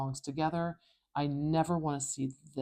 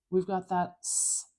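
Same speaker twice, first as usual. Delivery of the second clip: ordinary talk, nothing marked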